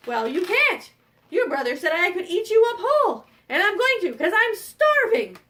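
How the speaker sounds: loud, threatening refusal; starving evil troll. Acted. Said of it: "the evil troll goes".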